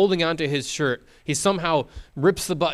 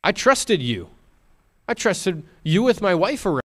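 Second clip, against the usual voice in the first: betrayed husband; 6 semitones higher, 5 dB louder than usual. Retold or imitated. Husband voiced in anger.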